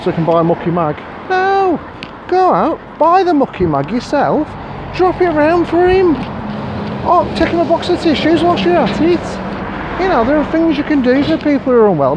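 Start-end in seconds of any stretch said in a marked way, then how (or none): 1.3-12.1 much higher, pushed, urgent insisting; exasperated rant. Real, not acted. Vlogger telling viewers to visit the sick in person, exasperated. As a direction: drive the point home, fired up. Ranting about card senders.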